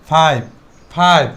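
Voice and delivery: loud, deliberate "ফাইভ" with an airy F. Shown as a demonstration. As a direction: push a little air through the F of "ফাইভ". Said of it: "একটু বাতাস বের করে".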